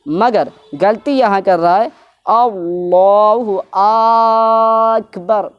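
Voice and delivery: اکبر stretched to اکبار, slow and high; mock faulty takbir; wrong Akbar. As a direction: imitate a reciter drawing out اکبر. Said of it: "اکبر کے اکبر کہہ رہا".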